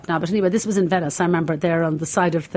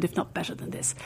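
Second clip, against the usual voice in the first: soft aside; 10 dB quieter than usual. Real, not acted. Proud aside.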